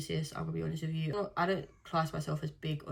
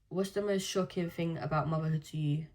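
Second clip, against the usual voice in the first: ordinary delivery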